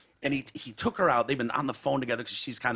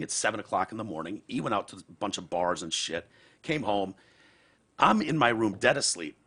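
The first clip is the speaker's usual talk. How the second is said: ordinary talk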